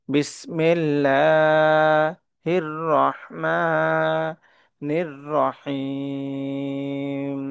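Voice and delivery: very slow, drawn out; slow teaching recitation; slow recitation to follow. Shown as a demonstration. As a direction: recite slowly so they can follow. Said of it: "আপনারা আমার সাথে সাথে পড়ুন".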